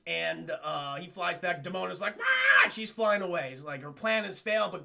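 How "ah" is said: high, loud frustrated scream; villain's mock scream. Demona foiled and fleeing, screaming. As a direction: shriek in thwarted rage.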